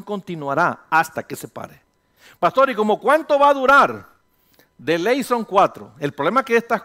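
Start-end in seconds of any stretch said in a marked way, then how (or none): 2.4-4.1 higher, louder worried asking voice; congregant's question. Acted. Pastor acting a worried member asking how long. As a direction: plays the anxious churchgoer asking.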